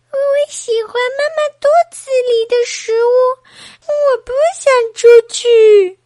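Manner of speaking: acted bawling in a baby voice; crying baby. A narrator's reading. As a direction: whine up high, then wail the refusal. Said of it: "宝宝又哭了".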